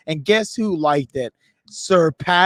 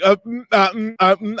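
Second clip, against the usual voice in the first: stammering hums and false starts; tongue-tied; 4 semitones higher than usual. Retold or imitated. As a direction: fumble for words, starstruck. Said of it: "I get tongue-tied".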